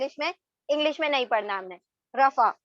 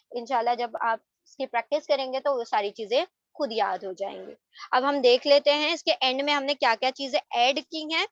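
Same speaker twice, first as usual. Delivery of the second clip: normal talking voice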